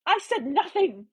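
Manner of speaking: high indignant protest; shrill denial. A narrator's reading. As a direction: raise the pitch in indignation. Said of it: "she shrilled".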